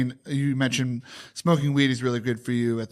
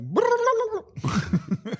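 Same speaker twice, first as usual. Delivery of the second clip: high squawking turkey gobble. Pretend. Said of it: "my turkey impression".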